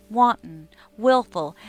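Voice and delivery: usual speaking voice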